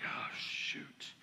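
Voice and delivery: breathless whisper; whispered panic; whispered dread. Retold or imitated. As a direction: whisper it like you're caught. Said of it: "And I'm like".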